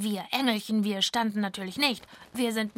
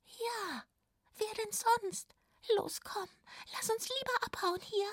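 much higher, much softer, slow little voices; tiny snowflakes; 11 semitones higher, 9 dB quieter than usual. Acted. Snowflake sisters softly introducing themselves to Pixiel.

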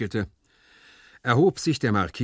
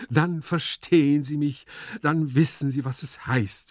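raised, louder, eager voice; enthusiastic; 7 semitones higher, 5 dB louder than usual. A narrator's reading. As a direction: build up to a confession. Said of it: "rief der Marchese begeistert". (begeistert, mit erhobener Stimme)

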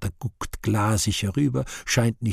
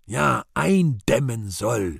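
put-on gurgling old god; 4 dB louder, much slower than usual. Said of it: "Gottvater kollernd".

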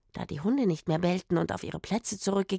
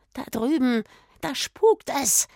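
put-on old woman's ominous outburst, pitched high; high, urgent, haunted warning; blurting it out; 4 semitones higher, 4 dB louder than usual. A narrator's reading. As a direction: blurt out a dread warning, drawing out the end. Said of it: "stieß die alte Frau hervor".

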